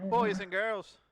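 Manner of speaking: put-on Irish accent, loud opening; booming Irish announcer. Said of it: "Is that your Irish accent?".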